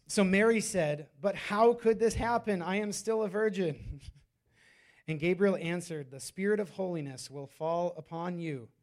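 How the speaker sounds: loud, measured reading to a congregation; scripture reading. Acted. Preacher reads Mary and Gabriel's exchange aloud, emphatically. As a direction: proclaim the verses with deliberate emphasis.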